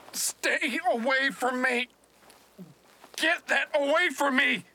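high, forceful shout; angry warning. Acted. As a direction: yell to drive them back. Warding off attackers.

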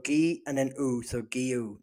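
"Giu" built from hard-g "Gi" plus "U"; syllable-by-syllable pronunciation. Shown as a demonstration. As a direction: sound out "Gi", then "U", then join them as "Giu". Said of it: "that's why I said it so confidently".

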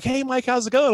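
lightly voiced friendly caller. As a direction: greet him like an old pal. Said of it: "He's like".

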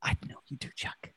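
breathy secret whisper. Acted. Lovers whisper reply.

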